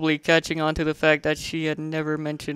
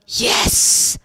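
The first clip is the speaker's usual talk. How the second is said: triumphant whisper; 9 dB louder than usual. A narrator's reading.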